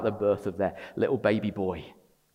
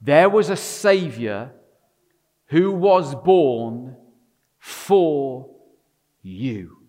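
each word slowly apart, louder; slow emphatic declaration; slow and deliberate; 2 semitones higher, 7 dB louder, much slower than usual. Shown as a demonstration. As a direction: let it land word by word. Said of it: "as I say this slowly over you".

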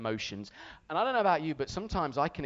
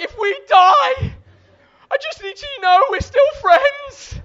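high, drawn-out mock-terrified yelling; panicked scream; 16 dB louder, slower than usual. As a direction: scream last words to a friend. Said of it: "just scream".

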